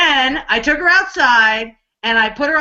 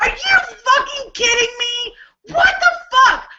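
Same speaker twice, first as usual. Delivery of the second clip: shocked outburst; 9 semitones higher than usual. Retold or imitated. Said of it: "And I'm like".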